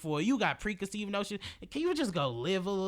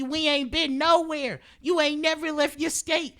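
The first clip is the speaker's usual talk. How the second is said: louder, higher, slow heated call-out; heated rant; 8 semitones higher, 6 dB louder, slower than usual. Real, not acted. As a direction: hammer the point home. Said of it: "heated rant".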